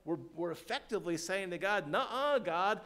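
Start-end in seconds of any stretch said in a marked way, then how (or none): 1.9-2.8 loud, high, emphatic refusal; acted willful sinner, voice raised hard; stubborn defiance. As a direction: defy God, hammering out each word. Acts a sinner defying God.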